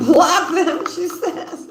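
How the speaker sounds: high, laughing delivery. Real, not acted. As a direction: burst out laughing through the words. Laughing while relaying a message.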